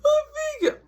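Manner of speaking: high whimpering wail; crying kid. Retold or imitated. Little brother crying over his finger, high wail. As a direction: whine about the sore finger. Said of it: "He like".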